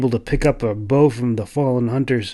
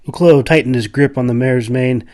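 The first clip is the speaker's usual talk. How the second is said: ordinary delivery, nothing marked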